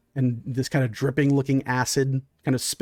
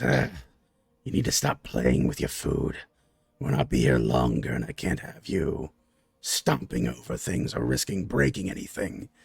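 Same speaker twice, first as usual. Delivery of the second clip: much lower, scolding and commanding; low softer reprimand; deep stern monster boss; 12 semitones lower, 5 dB quieter than usual. Acted. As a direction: talk down to the brute from deep in the chest. GM voices towering leader.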